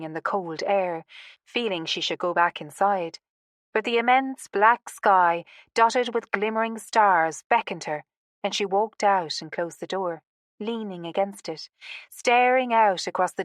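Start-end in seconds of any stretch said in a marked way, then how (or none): none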